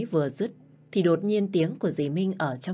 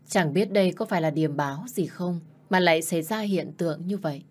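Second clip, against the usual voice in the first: plain reading voice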